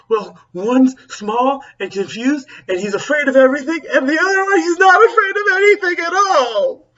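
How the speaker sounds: high and loud, climbing to a near squeal; rising panic describing her sons; frantic mom. Acted. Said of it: "she goes".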